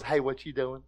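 a deep, easy, unbothered God checking in; God's voice. Retold or imitated. Pastor voices God catching a sinner, low and casual.

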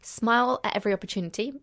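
ordinary talk

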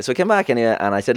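ordinary delivery

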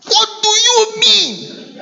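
high, slow indignant demand; retold scolding. Preacher retells scolding them.

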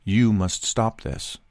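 low, slow, measured demand; firm quiet warning. Retold or imitated. Passenger squatting by the man behind him, low firm warning. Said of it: "And I say".

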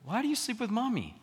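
put-on high, small child asking; pleading child voice. Father voicing his son asking to sleep with him, high and small. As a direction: ask like a little boy who wants company. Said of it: "He goes".

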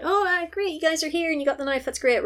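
high, fast eager greeting; a high, hurried welcome; excited greeting. Retold or imitated. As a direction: gush it out brightly. Retelling Eddie greeting them.

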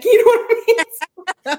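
high, slow laughing question. Real, not acted. Laughing after her bathtub admission.

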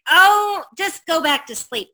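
annoyed brush-off. Retold or imitated. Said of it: "he's yelling at me. He's getting mad".

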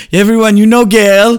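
high, loud grand announcement; vampire impression. Describing the show scene, loud theatrical vampire. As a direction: present him proudly. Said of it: "he's like".

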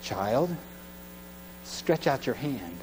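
low, soft and slow; tender command. Acted. Rabbi tells the man to stretch out his hand, slow and soft. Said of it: "This rabbi says".

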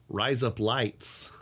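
"Rise up lights" drawn out slowly in a mock Aussie accent; Australian accent. Shown as a demonstration. Host teaches friends the Australian "Rise up lights", slow mock accent. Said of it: "if you wanna say razor blades".

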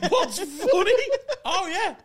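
high laughing echo. Retold or imitated. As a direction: squeak it out laughing. Repeats quote laughing loudly.

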